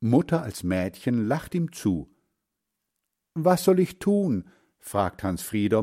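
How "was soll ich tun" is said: high-pitched, helpless question; anxious child's appeal; pleading boy. A narrator's reading. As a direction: ask mother for help, voice lifted. Boy asks mother's picture for help.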